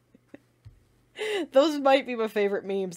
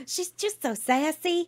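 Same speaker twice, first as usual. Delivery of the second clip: sassy; 9 semitones higher than usual. Pretend. Repeating with sassy flair.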